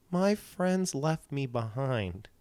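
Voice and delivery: put-on drunk lady voice. Imitating the drunk lady whimpering that her friends left. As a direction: mimic her lost, sad slur.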